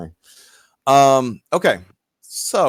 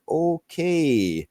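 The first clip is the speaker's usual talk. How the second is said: drawn-out, higher-pitched "okay" of an uneasy bystander; awkward okay; 5 semitones higher than usual. Acted. Bystander left out of a native-tongue argument, awkward okay. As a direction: stretch it out, unsure and out of the loop.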